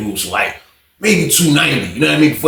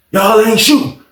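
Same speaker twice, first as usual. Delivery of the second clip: provoking taunt; 7 semitones higher, 4 dB louder than usual. Retold or imitated. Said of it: "It's the way he said it".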